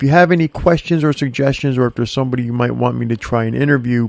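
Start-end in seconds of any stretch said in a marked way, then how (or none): none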